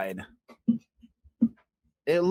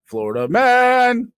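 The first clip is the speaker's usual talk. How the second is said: higher, louder, slow proclaimed sign-off; signature call-out; 10 semitones higher, 10 dB louder, slower than usual. Acted. Host closing the story with a big proud call. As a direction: boom it like a show title.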